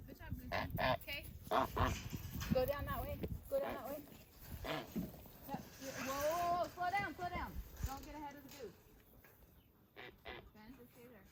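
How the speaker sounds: hushed, gentle calls to animals; soft coaxing voice. Real, not acted. Quietly herding geese.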